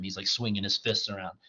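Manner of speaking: normal talking voice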